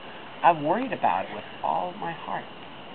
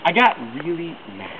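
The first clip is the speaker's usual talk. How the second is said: plain reading voice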